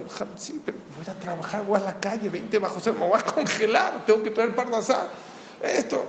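high, complaining husband's plea; husband pleading. Acted. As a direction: whine to the rabbi that he'll freeze. Esposo quejándose ante el rabino. (esposo quejumbroso, voz aguda)

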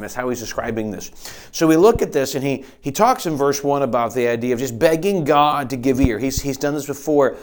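ordinary talk

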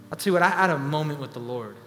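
low, soft, confiding over music; intimate quiet sermon. Acted. Preacher confiding to congregation, soft and low. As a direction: lean in and speak softly, like a confession.

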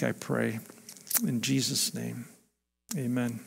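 soft, low closing prayer; hushed pastor's prayer at the end. Acted. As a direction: pray it quietly to the Father.

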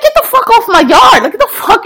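high, heated yell; retold angry yell. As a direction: chase him off your lawn up high. Neighbor ordering Marine off.

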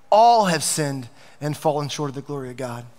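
all have sinned, weight on all; stress on all. Real, not acted. Pastor quoting scripture to the church, stressing all. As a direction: lean hard on all. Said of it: "Do you know how that word translates, all?".

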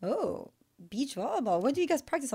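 mock driver confused; driver surprised. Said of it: "they're like".

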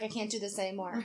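mumbled surrender halfway up the hill; defeated quiet. Retold or imitated. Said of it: "I was like".

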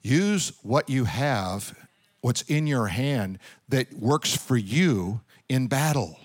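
soft, slow, low exhortation; earnest. Real, not acted. Preacher quietly urging the congregation to use what they have. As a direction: press it home gently and slowly.

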